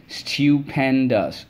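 "stupendous" stretched slowly as stu-pen-dous; syllable by syllable. Shown as a demonstration. Teacher reviewing words with the class, slowly syllable by syllable. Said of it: "Let's go".

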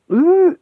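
high, loud wolf-whistle ooh; impressed ooh. Acted. Thug spotting Helga, high loud impressed ooh. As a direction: sound properly impressed.

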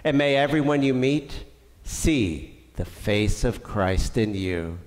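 ordinary delivery

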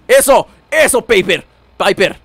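loud, excited cheer. Real, not acted. Gamer to companion fighting for him, loud cheering. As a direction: cheer your partner on loudly.

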